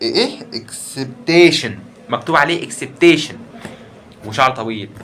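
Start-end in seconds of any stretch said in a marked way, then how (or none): 0.5-1.8 slow read-out. Real, not acted. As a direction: spell اكسبتيشن slowly, syllable by syllable. Slowly reading shirt text. (نطق بطيء لكلمة اكسبتيشن)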